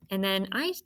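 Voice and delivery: ordinary talk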